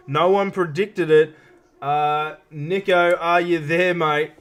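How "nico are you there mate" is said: ordinary delivery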